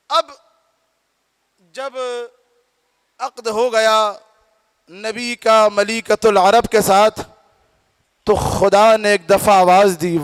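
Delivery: expound sacred words to a congregation; projected, measured declamation climbing toward the closing question; preaching. Acted. Preaches with recited verse.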